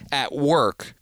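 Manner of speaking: ordinary talk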